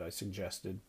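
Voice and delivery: ordinary delivery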